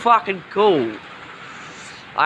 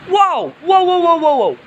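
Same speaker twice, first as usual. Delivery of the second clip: high frightened shouts; terrified horror victim; 8 semitones higher, 4 dB louder than usual. Real, not acted. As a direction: yelp as the thunder cracks. Lightning strikes close, shouting.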